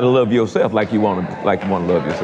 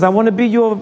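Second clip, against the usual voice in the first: lightly voiced French preacher, pitched up; mock high preacher voice; 12 semitones higher, faster than usual. Preacher mimics a driver in Paris, pitched-up mock voice. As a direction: imitate the driver asking to be your bestie.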